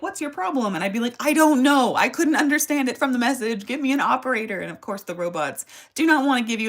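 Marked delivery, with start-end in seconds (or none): 0.0-0.7 high curt automated voice; robot voice. Retold or imitated. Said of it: "automated voice message machine that would be like". 1.2-4.6 louder, higher, fed-up demands; exasperated yell. Retold or imitated. Yelling at phone robot.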